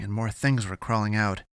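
ordinary delivery

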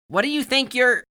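high, loud outburst, cut short; indignant. A narrator's reading. Barry starts to challenge Alex before being interrupted, indignant. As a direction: protest loudly in a high, outraged pitch. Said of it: "Barry's indignation was palpable".

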